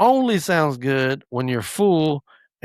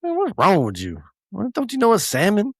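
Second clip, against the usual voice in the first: rising higher, teasing disbelief; mock disbelief; 8 semitones higher than usual. Dad teasing his son for loving salmon, high mock disbelief. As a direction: rib him for loving salmon. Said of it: "I'm like".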